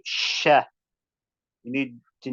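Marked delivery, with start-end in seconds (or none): 0.0-0.6 SHA with a long rush of air; airy, hissed onset on "sha"; spreading sh sound. Shown as a demonstration. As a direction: let the air out openly through the sh of "sha". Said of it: "have that open, openness".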